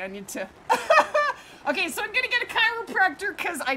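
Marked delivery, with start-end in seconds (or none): 0.7-1.4 high, loud burst of laughter; real laugh. Real, not acted. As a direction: laugh it out at your own bad back. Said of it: "I laugh, but it's not funny".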